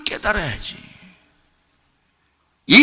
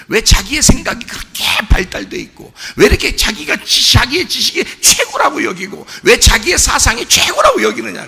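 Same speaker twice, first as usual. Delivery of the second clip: raised preacher's voice, near shouting; preaching at full force; 5 semitones higher, 4 dB louder than usual. Acted. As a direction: build the rebuke line by line. (목소리 높여 꾸짖는 설교)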